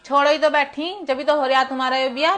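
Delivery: normal talking voice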